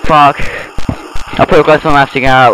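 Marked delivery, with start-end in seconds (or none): none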